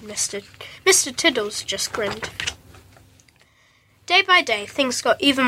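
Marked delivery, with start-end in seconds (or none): none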